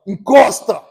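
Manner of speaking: a cop ordering the car to pull over; police command. Retold or imitated.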